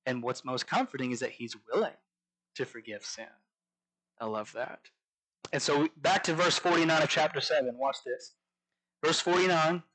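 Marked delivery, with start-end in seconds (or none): none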